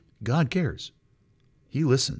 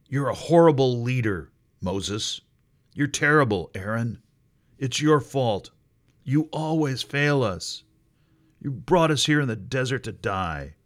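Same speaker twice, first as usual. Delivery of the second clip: louder accusing rant at the leaders; angry crowd; 4 semitones higher, 5 dB louder than usual. Acted. Israelites blaming Moses and Aaron, louder angry accusation. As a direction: hurl blame like a bitter mob.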